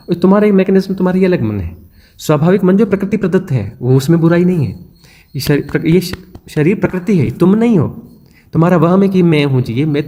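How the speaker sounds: ordinary delivery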